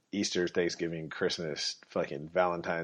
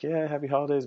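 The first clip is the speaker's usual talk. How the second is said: fake cheer; 8 semitones higher, faster than usual. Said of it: "I'll be like".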